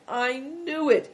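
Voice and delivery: high, slow, anguished cry; despairing cry. Acted. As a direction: draw it out in despair. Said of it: "he cried despairingly".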